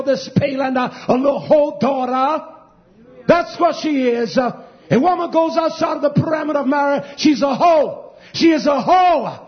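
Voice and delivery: loud, high-pitched tirade from the pulpit; thundering denunciation to the congregation; fiery preaching. Real, not acted. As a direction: preach in full-force outrage, building to a shout. Preaches at full force.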